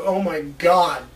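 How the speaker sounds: normal talking voice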